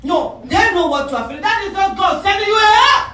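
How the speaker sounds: high, shouted preaching; forceful rebuttal. Real, not acted. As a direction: build to a full-force pulpit shout. Emphatic rebuttal, preaching at full force.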